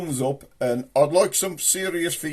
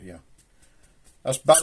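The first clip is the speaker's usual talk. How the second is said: ordinary talk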